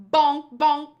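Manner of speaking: loud, percussive comic hits; punchy sound effect. Acted. Narrator voicing hits over the head, punchy effect. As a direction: punch out each word like a blow.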